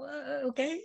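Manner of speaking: high, puzzled okay; a baffled rising okay; surprised. Real, not acted. Reacting to priest's surprise arrival.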